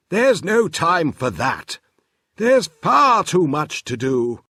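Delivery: put-on busy inventor brushing the children off; Professor Tangle voice.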